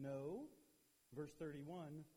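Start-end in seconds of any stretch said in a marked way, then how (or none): none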